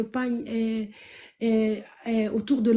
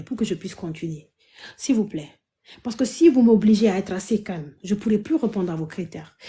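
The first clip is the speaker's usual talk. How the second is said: ordinary talk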